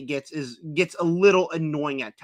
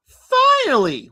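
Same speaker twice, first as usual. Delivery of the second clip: high, slow, louder "finally"; drawn-out finally; 12 semitones higher, 7 dB louder, much slower than usual. Real, not acted. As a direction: stretch it like a big reveal. Drawn-out announcement.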